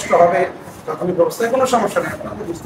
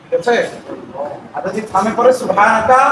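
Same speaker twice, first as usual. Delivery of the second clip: ordinary delivery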